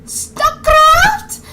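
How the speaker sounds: high, loud belt of a title; mock-epic high holler; hammed-up fan cry. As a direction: burst out like a superfan. Excited cry of game title.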